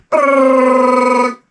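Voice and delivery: lips buzzing brrr like a fan blade; imitated fan buzz. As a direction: blow a loud high brrr like spinning blades.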